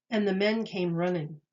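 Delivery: ordinary delivery, nothing marked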